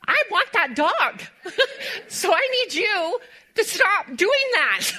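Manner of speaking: teary laughter through the punchline; laughing punchline. Retold or imitated. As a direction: deliver his line while breaking up. Husband's punchline amid laughter.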